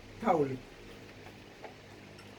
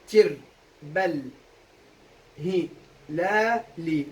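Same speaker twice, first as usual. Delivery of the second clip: syllable-by-syllable scansion; 3 semitones lower, much slower than usual. Shown as a demonstration. Teacher scanning the verse for students, one syllable at a time. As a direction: separate syllables, pauses between. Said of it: "قطعناهم، صح؟".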